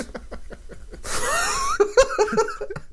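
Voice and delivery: high burst of laughter. Real, not acted. Laughs at the bird joke.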